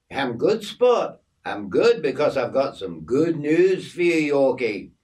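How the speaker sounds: lively story-character voice; cheery Aussie mate. Acted. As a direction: play the chummy Aussie bearing good news.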